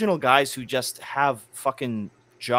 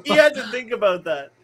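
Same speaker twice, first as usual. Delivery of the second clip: laughing through the words, higher and louder; 8 semitones higher, 5 dB louder than usual. Real, not acted. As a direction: crack up mid-sentence. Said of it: "laughing while speaking".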